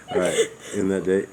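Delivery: laughing. Real, not acted. Giggling over a typo.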